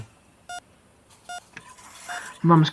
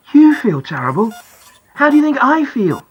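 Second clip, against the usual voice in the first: indignant retort; 5 semitones higher, 4 dB louder than usual. A narrator's reading. Boy silently retorts to driver.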